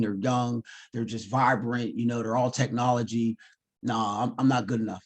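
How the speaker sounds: retelling a tearful confession to his wife; crying, defeated. Retold or imitated. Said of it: "I'm crying and stuff".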